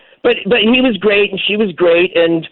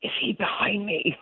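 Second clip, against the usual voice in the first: barely voiced, much softer mouthed question; 2 semitones higher, 12 dB quieter than usual. Guest retelling a silent question to Barbara, mouthed softly. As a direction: mouth the words almost silently. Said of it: "I mouth".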